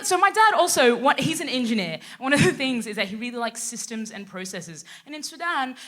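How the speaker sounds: ordinary talk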